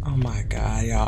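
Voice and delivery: hushed frightened whisper. Real, not acted. Sneaking through the dark house, scared whisper. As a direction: breathe it out so nothing hears you.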